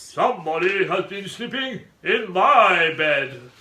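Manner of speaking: loud, slow growling bear; great big growly voice. A narrator's reading. Father Bear finding his bed slept in, great growl. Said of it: "in his great big growly voice".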